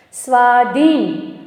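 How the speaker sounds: સ્વાધીન drawn out slowly, both long vowels held; સ્વાધીન said slowly to show the "aa"; sounded-out word. Shown as a demonstration. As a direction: stretch સ્વા, then ધીન. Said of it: "શબ્દ બોલો".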